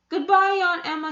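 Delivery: a lightly voiced polite boy; polite little farewell. A narrator's reading. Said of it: "he said politely".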